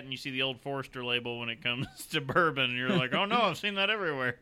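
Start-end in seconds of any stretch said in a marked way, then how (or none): 3.1-4.4 exasperated quote. Retold or imitated. Acted quote of a shopper.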